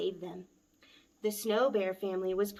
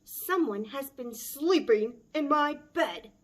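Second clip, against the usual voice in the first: loud, higher big bear bellow; bellowing Papa Bear; 4 semitones higher, 5 dB louder than usual. Acted. Papa Bear finds his bed slept in, loud bellow. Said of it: "Papa Bear bellowed".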